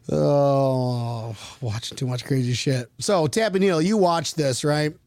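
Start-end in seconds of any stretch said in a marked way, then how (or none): none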